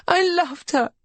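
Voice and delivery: high, sobbing grief. A narrator's reading. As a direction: sob the words out. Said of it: "she sobs".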